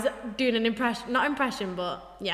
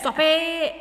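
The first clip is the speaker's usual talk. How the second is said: higher broad British "Stop it"; old high-school accent; 5 semitones higher than usual. Shown as a demonstration. Imitating their teenage self, broad British school accent. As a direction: exclaim in a thick home British accent. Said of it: "at high school, I'd be like".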